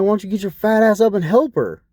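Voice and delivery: high, mocking jab; scornful retort. Mocking retort to old lady.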